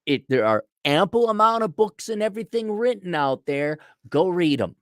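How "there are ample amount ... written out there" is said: usual speaking voice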